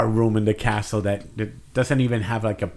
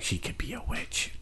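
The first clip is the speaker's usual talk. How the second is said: very soft, conspiratorial whisper; gossipy whisper; 14 dB quieter than usual. Acted. Courtier spreading a witch rumor to others, gossipy whisper.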